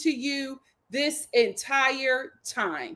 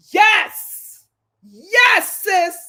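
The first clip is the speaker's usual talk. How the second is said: excited cheer; 6 semitones higher, 8 dB louder, much slower than usual. Real, not acted. Cheering her friend on.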